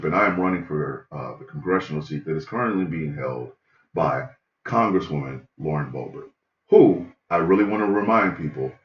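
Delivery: a stiff politician giving opening remarks; mock candidate. Comedian playing her opponent, low formal debate voice.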